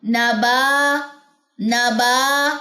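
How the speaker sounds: نبا with the long aa melting away into air; madd fading into the air. Shown as a demonstration. Teacher demonstrates the vanishing long aa to students, drawn out gently. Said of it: "آواز کو غائب کرنا ہے".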